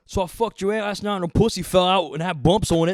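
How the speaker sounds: high, loud schoolkid bragging; loud crude kid boast. Retold or imitated. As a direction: shout the joke to the lunch table. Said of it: "Hey, Brad".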